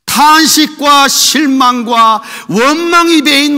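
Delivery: very slow, loud emphatic preaching; forceful preaching. Acted. Preacher stressing Elijah's lament, slow and loud. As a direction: stretch each word out loud.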